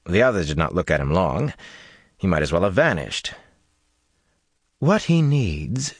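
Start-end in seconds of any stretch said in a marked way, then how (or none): 4.8-5.9 slow, high, firm woman's voice. A narrator's reading. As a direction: lay it down slowly and firmly. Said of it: "Jolene said firmly".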